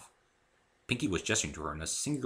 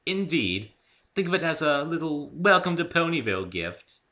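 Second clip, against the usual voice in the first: high refined pony lady; gracious unicorn; Rarity voice; 9 semitones higher, 6 dB louder than usual. A narrator's reading. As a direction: elegant Rarity gift. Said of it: "Rarity speaking".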